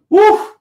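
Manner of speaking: loud, high whoop of excitement; excited whoop. Real, not acted. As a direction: let out a worked-up whoop. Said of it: "I'm getting pretty amped up and vexed".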